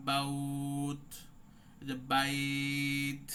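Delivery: বাউত and বাইত drawn out very slowly; very stretched. Shown as a demonstration. Teacher showing the stretch when stopping. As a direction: hold the diphthong of বাইত long. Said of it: "টান হবে".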